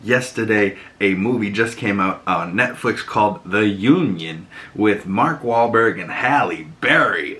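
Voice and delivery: ordinary talk, nothing marked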